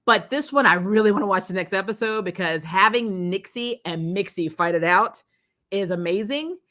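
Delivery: normal talking voice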